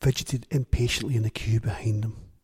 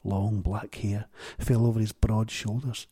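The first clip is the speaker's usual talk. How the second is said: plain reading voice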